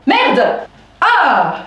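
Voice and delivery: high-pitched exasperated outburst; displeased. Real, not acted. Woman vents to viewers about the theft, displeased. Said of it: "Je suis pas contente".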